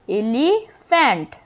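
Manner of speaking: "এলিফ্যান্ট" slowly, pausing between "এলি" and "ফ্যান্ট"; split into syllables. Shown as a demonstration. Teacher showing two syllables, "এলিফ্যান্ট" slowly split as "এলি", "ফ্যান্ট". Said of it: "এলি একটা syllable আর ফ্যান্ট একটা".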